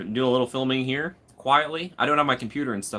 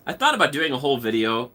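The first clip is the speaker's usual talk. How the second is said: ordinary talk, nothing marked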